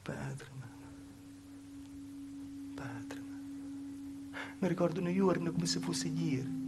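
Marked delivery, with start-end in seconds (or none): 0.0-4.4 soft unvoiced whisper; whispered plea. Daughter pleading with her father in a whisper. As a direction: breathe the words as a broken plea.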